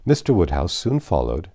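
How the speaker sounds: plain reading voice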